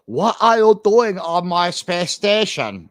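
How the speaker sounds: slow mock alien accent; exaggerated imitation of an unplaceable accent; Michelle Yeoh's accent. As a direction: drag it out in an odd put-on accent. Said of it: "with Michelle Yeoh's new accent".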